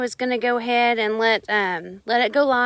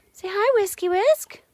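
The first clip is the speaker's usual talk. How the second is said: pet talk; 9 semitones higher than usual. Real, not acted. Talking sweetly to her dog.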